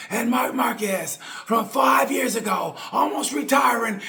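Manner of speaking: high, slow shouted race call; commentator's call. Retold or imitated. Vlogger imitating the race commentator's call, a high, slow shout. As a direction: belt it out like the TV commentator at the finish line. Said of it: "And he go".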